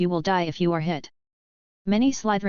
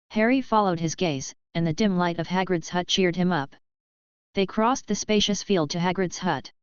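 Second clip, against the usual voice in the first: ordinary delivery, nothing marked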